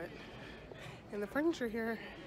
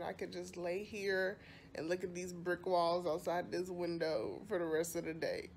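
normal talking voice

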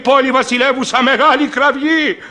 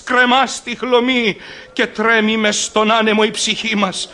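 normal talking voice

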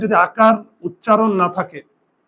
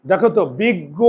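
ordinary talk, nothing marked

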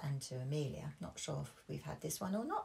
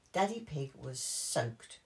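usual speaking voice

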